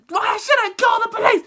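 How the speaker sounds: high loud teacher yell; hammed-up furious teacher; mock shouted threat. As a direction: bellow the threat in her voice.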